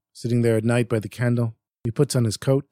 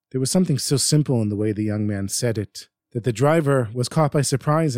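usual speaking voice

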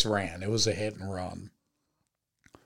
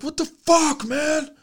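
much higher, louder furious shout; imitating furious yelling; 7 dB louder, slower than usual. Rammed driver yelling at the man who hit him, furious high shout. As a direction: mimic the rammed driver screaming at you. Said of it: "He's like".

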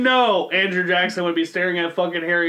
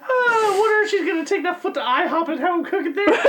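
high-pitched, laughing through the words; helpless laughter; 10 semitones higher than usual. Real, not acted. Wondering about the foot at a restaurant, laughing. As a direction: squeal it out while cracking up. Cracking up at own joke.

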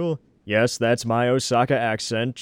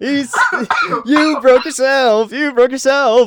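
high, loud mock accusation; loud teasing; 13 semitones higher, 10 dB louder than usual. Real, not acted. Cohost loudly teasing a friend who broke himself. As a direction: crow it at him, slowly.

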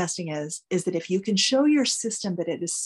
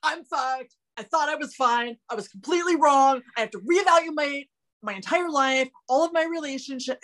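panicked spiral; 5 semitones higher than usual. Retold or imitated. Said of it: "the deeper you get in the room".

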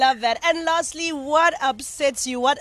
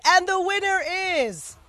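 pageant host announcement; 8 semitones higher, 3 dB louder than usual. Retold or imitated. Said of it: "when they said".

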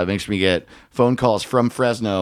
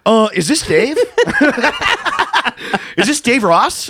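high put-on caller voice, playing it innocent; mock prank caller; 11 semitones higher than usual. Friend on the phone plays a prank caller, high and hesitant.

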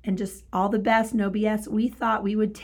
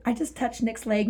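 ordinary talk

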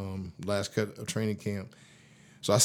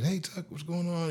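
high-pitched, softer breezy greeting; energetic coach; 8 semitones higher, 5 dB quieter than usual. Retold or imitated. Head coach greeting a player in the locker room, high and lively. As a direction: imitate a lively coach calling hello, pitched up. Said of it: "he was a real fiery, energetic guy".

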